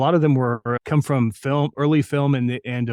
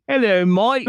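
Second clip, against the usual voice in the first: much higher, louder child's British accent, badly faked; 9 semitones higher, 4 dB louder, slower than usual. Kid from New Mexico faking British for audition, bad accent. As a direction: ham up a kid's attempt at British English. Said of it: "It was, it was horrible".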